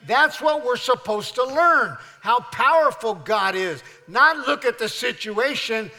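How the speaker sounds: loud, high, rousing sermon climax; full-force preaching. Acted. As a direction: preach it out to the whole church.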